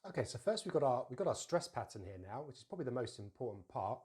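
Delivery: normal talking voice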